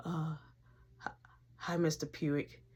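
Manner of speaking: scared, breathless whispered hello; nervous whisper. Retold or imitated. Kid greeting his teacher in a scared whisper. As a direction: whisper it like you're terrified.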